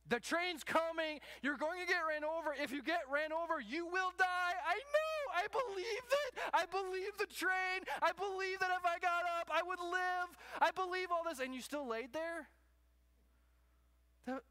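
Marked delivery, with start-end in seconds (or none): 0.1-4.5 louder, higher, pleading; urgent warning. Pretend. Preacher warning a person on the train tracks, urgent and loud. 4.7-11.4 high, loud, whiny insistence; mock stubborn believer. Person lying on the tracks insisting they believe, high whiny voice.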